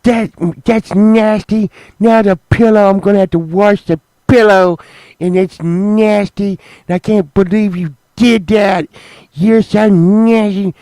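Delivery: high, loud, disgusted mock complaint; mad wife. Imitating Sunshine furious about the pillow, high and loud. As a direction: whine it up high like an outraged wife. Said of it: "she was so mad".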